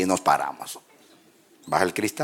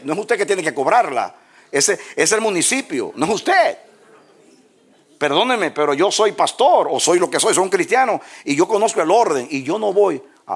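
firm loud refusal; 10 semitones higher, 6 dB louder than usual. Pretend. Pastor refusing to bribe the policeman, loud high defiance. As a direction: stand your ground against the officer.

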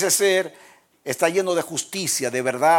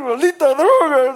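higher, louder bitter curse; angry curse; 12 semitones higher, 9 dB louder than usual. Retold or imitated. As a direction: spit it out in frustration. Relapsing addict curses the drug.

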